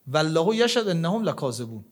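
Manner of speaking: ordinary talk, nothing marked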